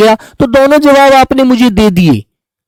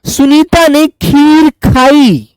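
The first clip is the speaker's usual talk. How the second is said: slow corrected sentence; 4 semitones higher, much slower than usual. Shown as a demonstration. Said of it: "गलत".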